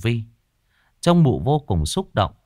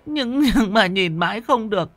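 high, stammering confession, close to tears; old woman confessing; 12 semitones higher than usual. Acted. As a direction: spill the secret in a frightened rush. (giọng bà già run rẩy thú tội)

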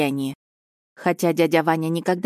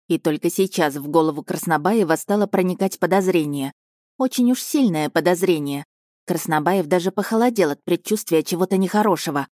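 plain reading voice

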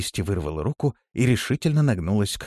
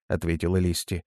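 plain reading voice

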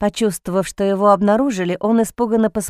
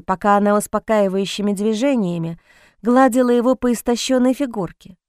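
usual speaking voice

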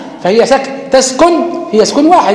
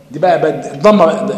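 usual speaking voice

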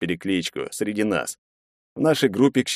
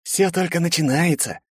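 high-pitched menacing rasp; хрип; 9 semitones higher than usual. A narrator's reading. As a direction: rasp the threat out. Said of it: "прохрипел мне". (угрожающе прохрипеть)

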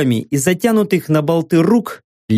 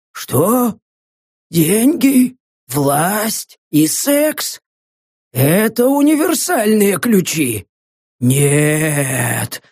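delirious muttering; 2 semitones higher, much slower than usual. Acted. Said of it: "лихорадочное бормотание".

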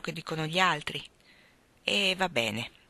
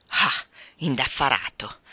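slow, lower, mocking repetition of the excuse; ironic echo; 5 semitones lower, slower than usual. Reverend mocks the young man's excuse, slow ironic echo. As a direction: drag the word out with dry disbelief. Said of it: "ripeté con tono ironico".

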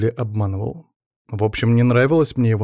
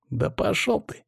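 high, muted irritated retort; snapping back; 9 semitones higher, 4 dB quieter, slower than usual. A narrator's reading. Pavel brushes off Ivan's reproach, snapping back. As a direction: snap back without raising the volume. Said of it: "огрызнулся Павел".

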